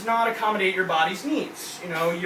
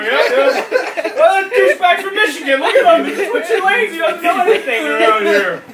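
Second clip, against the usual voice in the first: louder, higher sneering rant at other drivers; mock scornful driver; 7 semitones higher, 7 dB louder than usual. Judging drivers by license plates.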